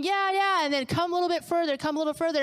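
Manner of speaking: high, eager encouragement; coaxing dad. Retold or imitated. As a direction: imitate a dad coaxing a child onward. Dad coaxing daughter into the waves.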